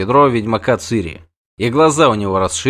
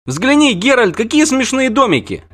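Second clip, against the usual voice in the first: put-on much higher child's voice, excited; delighted little girl; 13 semitones higher than usual. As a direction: squeal it like a thrilled child. Ciri delighted.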